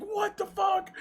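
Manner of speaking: a high mock shriek of a panicked mom; mom's shriek. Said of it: "she screams".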